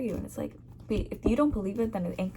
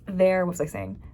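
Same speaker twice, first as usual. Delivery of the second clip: ordinary delivery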